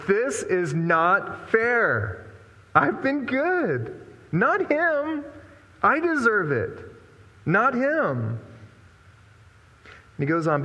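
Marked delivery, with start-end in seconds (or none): 0.1-8.4 put-on high, drawn-out childish whine, slow; whiny complaint. Older son whining to his father it isn't fair. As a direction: stretch it out like a sulking kid. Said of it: "But he answered his father".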